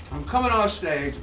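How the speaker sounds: ordinary talk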